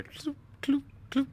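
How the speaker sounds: cloop sound effect. Retold or imitated. GM voicing coins hitting water.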